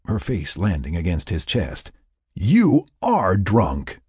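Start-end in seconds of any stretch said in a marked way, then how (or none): 2.3-4.0 put-on slow, each word of "You are drunk" weighted; firm insistence. A narrator's reading. Mac insisting to Sarah, slow stressed "You are drunk". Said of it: "he insisted".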